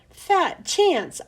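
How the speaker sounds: squeaky hamster voice. A narrator's reading. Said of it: "I squeaked".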